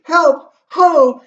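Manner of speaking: very high, loud shout for help; crying out. Acted. Gertrude calling for help, crying out loudly. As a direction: call out urgently at high pitch. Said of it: "she cries out".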